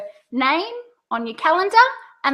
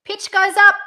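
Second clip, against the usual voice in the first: loud high lift on "up"; pitch going up; 7 semitones higher, 7 dB louder than usual. Shown as a demonstration. Coach repeats the first option's tune, pitch up. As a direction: raise the whole phrase, "up" highest.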